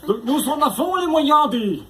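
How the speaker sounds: high, slow, exaggerated German menace; laboured German accent. Torturer retrying the threat on a prisoner, careful mock German accent. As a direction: lay on the German accent, trying hard. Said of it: "moi je vais m'appliquer".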